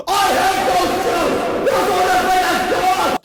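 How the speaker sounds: bully losing it; frantic yell. Acted. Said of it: "Kipper yelled".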